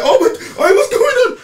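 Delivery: high, panicked mock shriek through laughter; mock scream. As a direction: squeal it like a frightened passenger, half laughing. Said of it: "Brandy's screaming".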